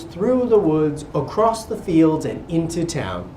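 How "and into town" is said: plain reading voice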